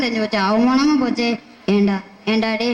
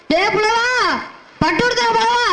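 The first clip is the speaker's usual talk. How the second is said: high-pitched, hammed-up wail; comic wailing call; 8 semitones higher than usual. Poor poet crying out to the poet in a high comic wail. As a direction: cry out high like a mock mourner.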